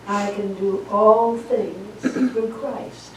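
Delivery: slow and deliberate, each word given weight; measured scripture reading; slow reverent quote. Acted. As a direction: lay the verse down slowly. Quoting the verse reverently.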